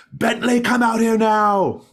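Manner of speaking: high, full-force angry yell; crying out. Acted. Old man summoning the inn owner, furious shout. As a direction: demand the innkeeper at the top of your voice. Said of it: "he cries out to the inn owner".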